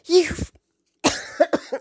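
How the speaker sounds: high retold yell cut short by a cough; imitating her screaming outburst. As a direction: start a mock scream, then break off. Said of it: "screaming at him at the top".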